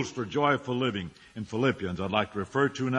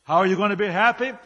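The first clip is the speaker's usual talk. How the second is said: ordinary talk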